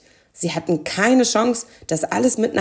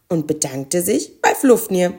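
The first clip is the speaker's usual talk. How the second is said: usual speaking voice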